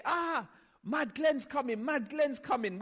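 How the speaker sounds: high, slow mock shout of kids; kids calling out. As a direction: call out the warning like a scared kid.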